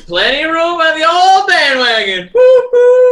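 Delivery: loud, high gloating whoop; gloating cheer. Real, not acted. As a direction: cheer him onto the bandwagon.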